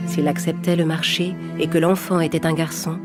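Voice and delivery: ordinary delivery, nothing marked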